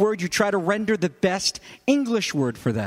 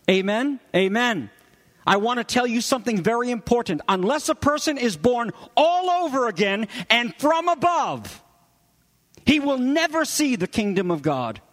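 higher-pitched proclamation, "all over again" punched; emphatic proclamation; 5 semitones higher than usual. Acted. Preacher renders Jesus' words to Nicodemus, emphatic proclamation. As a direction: drive home "all over again" and "from above". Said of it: "the best way to render the meaning".

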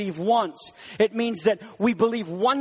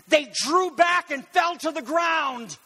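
dramatic shout; 6 semitones higher than usual. A narrator's reading. Preacher telling how the mob fell back, shouting. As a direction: proclaim it slow and strong. Climax of garden story.

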